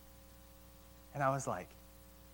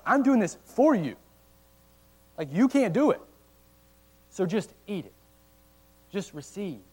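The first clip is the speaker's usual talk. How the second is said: higher and louder, pleading as Christ; voicing Christ; 5 semitones higher, 6 dB louder than usual. Preacher speaking as Christ to the disciples, loud and pleading. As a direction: call out the offer as Christ would.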